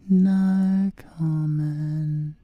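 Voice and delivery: loud stretched taunt; drawn-out loud no comment. Acted. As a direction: belt it out slowly to mock. Stonewalling with varied repeats.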